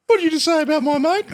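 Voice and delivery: high, loud mock challenge, squaring up to a bigger man; mock tough bloke. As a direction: play the hothead who takes on anyone. Acting a player squaring up.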